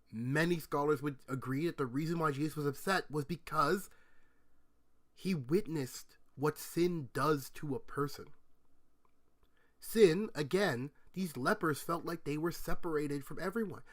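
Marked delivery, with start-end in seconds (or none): none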